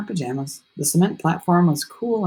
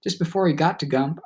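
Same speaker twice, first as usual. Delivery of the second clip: ordinary delivery, nothing marked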